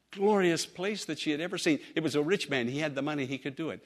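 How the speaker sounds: ordinary talk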